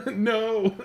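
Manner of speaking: high laughing protest; a giggling burst of denial. Real, not acted. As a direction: yelp it out mid-laugh. Laughing protest at chat joke.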